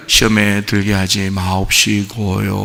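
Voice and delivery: soft, slow humble 마옵시고요; soft reverent prayer wording; timid polite prayer. Shown as a demonstration. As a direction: mumble 시험에 들게 하지 마옵시고요 meekly. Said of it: "그것도 이제 우리가".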